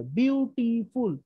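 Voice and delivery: বিউটিফুল said slowly, syllable by syllable, as বিউ-টি-ফুল; split into syllables. Shown as a demonstration. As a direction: spell out বিউটিফুল in three slow beats, বিউ, টি, ফুল. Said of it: "তিনটা ধাপে উচ্চারণ করা হয়".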